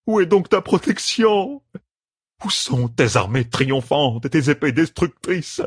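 high, wailing madman's lament; crazed blinded lord, anguished. Acted. Blinded lord calls on his dark master, crazed and anguished. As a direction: rave in torment, climbing to a high frantic cry.

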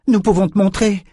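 high-pitched earnest appeal; urgent insistence. A narrator's reading. Callan tries to convince the enraged girl, high urgent appeal.

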